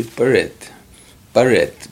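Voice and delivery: "barret" for bread, vowel put between b and r; Creeified English word. Shown as a demonstration. Cree speaker showing how people borrow English bread, Creeified pronunciation. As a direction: break bread into two syllables, "barret". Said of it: "they Creeify it".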